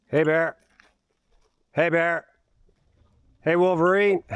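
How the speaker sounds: loud warning call to wildlife. Real, not acted. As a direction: call out to scare off bears. Calling out to warn off bears.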